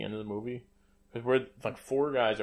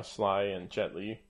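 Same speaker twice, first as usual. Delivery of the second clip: ordinary delivery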